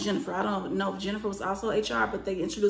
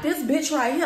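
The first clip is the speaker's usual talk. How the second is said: loud, higher call-out; single someone out with raised voice; heated, scornful retold yell; 6 semitones higher, 6 dB louder than usual. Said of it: "I'm screaming".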